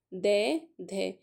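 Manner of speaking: दै, धै drawn out very slowly, tongue on the teeth; slow sound demo. Shown as a demonstration. Teacher sounding out dental letters for students, very slow. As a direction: stretch out दै and धै syllable by syllable. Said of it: "बोल के देख लो".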